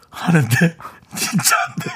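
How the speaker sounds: ordinary talk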